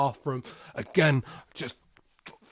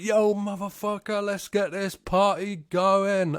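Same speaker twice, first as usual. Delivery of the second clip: party guys; 6 semitones higher, 3 dB louder than usual. Retold or imitated. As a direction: drag out a stoner party cheer. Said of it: "They're like".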